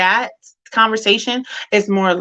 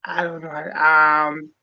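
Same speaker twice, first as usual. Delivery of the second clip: ordinary delivery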